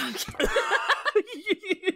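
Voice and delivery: slow, high, laughing it off; laughing I'm kidding. Real, not acted. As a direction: giggle the words out. Laughing off a joke.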